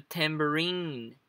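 tambourine drawn out on the last syllable RINE; stress on final RINE. Shown as a demonstration. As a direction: slowly lean on RINE in tambourine. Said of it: "aqui vai entrar a entonação". (tambourine com a tônica no rine final)

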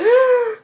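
high-pitched screaming gasp; mock gasp and scream; big imitated gasp. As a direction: suck in air with a squeal. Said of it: "with this big gasp and scream or whatever".

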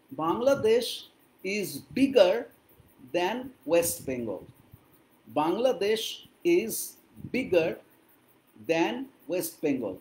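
slow English in separate chunks; slow model sentence. Shown as a demonstration. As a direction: dictate it phrase by phrase, pausing between. Teacher models English sentence slowly. (ধীরে ধীরে, থেমে থেমে ইংরেজি)

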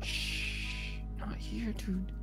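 hushed, very soft warning; urgent hushing. Acted. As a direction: shush a friend so nobody overhears. Friend hushes him in public.

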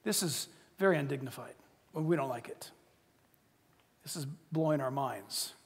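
mock the stuffy complainers under your breath; a hint of sniffy self-righteous people; self-righteous grumble. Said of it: "every self-righteous individual is saying".

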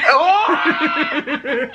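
high laughing cry; surprised laughing shout. Real, not acted. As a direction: burst out in shock. Startled by mom's answer.

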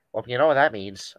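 ordinary delivery

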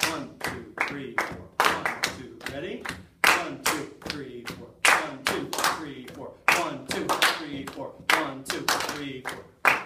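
loud counting over the class clapping; leading the offbeat clap; shouted count over clapping. Shown as a demonstration. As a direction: call out the beat over the noise. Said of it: "one clap in between the two and three beats".

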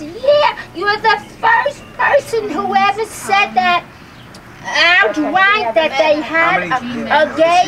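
retold admiring neighbor, high and breathless with laughter; gushing neighbor. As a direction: gush the praise up high, half laughing. Neighbor quoted continuing her praise.

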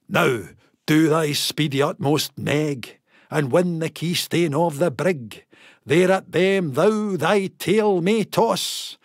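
plain reading voice

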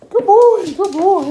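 very high, loud praise voice; dog praise. Real, not acted. Praising dog after sitting.

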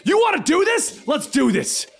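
eager challenge. A narrator's reading. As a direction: fire it off, ready to fight.

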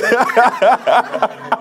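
amused burst of laughing; real laughter. Real, not acted. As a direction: laugh along at your own joke. Laughs at own joke.

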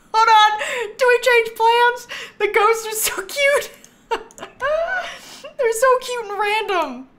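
high, loud and laughing, giddy with excitement; delighted shrieking laughter; squealing delight. Real, not acted. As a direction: squeal over how cute it is. Thrilled by cute ghost wallpaper.

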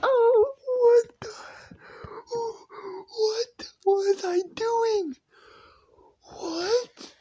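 very high, loud squealing through laughter; cracking up in high-pitched disbelief; laughing squeal. Real, not acted. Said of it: "I am laughing".

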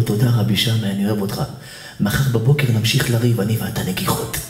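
low, calm, settled voice; tender reconciliation. Acted. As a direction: make peace in a low voice. Acted reconciliation of two rabbis. (פיוס רגוע בקול נמוך)

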